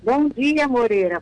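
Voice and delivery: alto e agudo, puxando a resposta; saudação ditada em voz alta. Real, not acted. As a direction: dite o cumprimento bem alto para a mãe. Filha dita a saudação para a mãe. (saudação em voz alta, ditando para a mãe)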